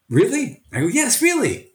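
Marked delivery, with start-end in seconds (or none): 0.1-0.6 high-pitched questioning disbelief; incredulous, raised-pitch query; doubtful question. Retold or imitated. Young teachers question his advice. 0.9-1.7 high, insistent reassurance; acted-out emphatic yes, pitched high; emphatic confirmation. He answers the doubting trainees.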